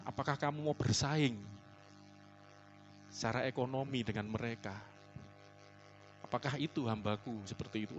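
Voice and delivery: soft, hushed questioning; gentle voice of God. Retold or imitated. God gently rebuking his servant, soft and quiet. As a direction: speak softly, as God gently asking. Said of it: "lembut suara Tuhan".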